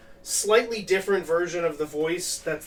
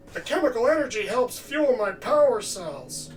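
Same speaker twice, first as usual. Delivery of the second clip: gravelly robot voice pitched up, rasping from the throat; early Bender voice; 7 semitones higher than usual. Shown as a demonstration. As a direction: rasp it out like a brash cartoon robot. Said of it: "this really low thing down here".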